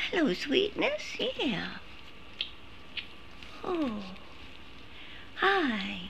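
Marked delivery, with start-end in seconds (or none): 0.1-1.2 cooing high and hushed at the pet; soft high pet voice, almost a sigh. Real, not acted.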